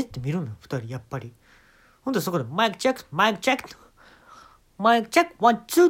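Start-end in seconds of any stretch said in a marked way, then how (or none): 2.5-5.9 loud, higher sound check; mock sound check. Comedian covering his moans as a sound check, loud mic check. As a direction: bark the mic check innocently. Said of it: "って言うんですよ".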